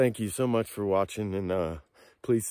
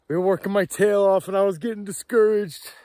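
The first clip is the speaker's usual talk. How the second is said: higher and louder, voice full of emotion; choked up; 8 semitones higher, 7 dB louder than usual. Real, not acted. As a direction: let the relief pour out. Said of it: "I'm emotional".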